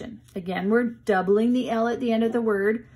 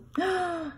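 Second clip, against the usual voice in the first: gasp; 8 semitones higher, 5 dB quieter than usual. Acted. Dog crashes into cake.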